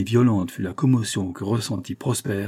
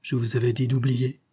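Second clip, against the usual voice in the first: plain reading voice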